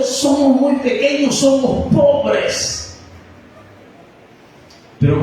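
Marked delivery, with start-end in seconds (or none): 0.0-3.0 high-pitched mock plea of smallness; imitated poor-me protest, drawn out at the end; acted excuse. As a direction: plead it high, stretching the final words. Quoting someone's excuse to God.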